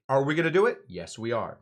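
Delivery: self-answered question. Shown as a demonstration. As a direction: ask it big, then answer yourself smugly. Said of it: "people ask questions to make themselves sound smart".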